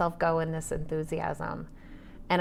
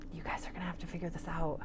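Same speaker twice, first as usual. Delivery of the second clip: quick whisper; 9 dB quieter, faster than usual. Retold or imitated. Imagined words to her kids.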